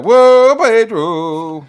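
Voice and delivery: loud, high surprised welcome; excited greeting. Real, not acted. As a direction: call out to a friend who just joined.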